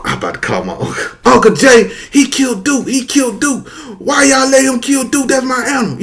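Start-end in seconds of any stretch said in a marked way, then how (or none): 1.2-6.0 high-pitched tearful complaint; mock wailing child telling on someone; distraught. Boy telling his uncle who killed his cow, distraught.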